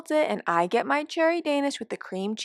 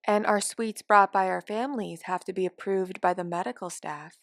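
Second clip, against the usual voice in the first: ordinary delivery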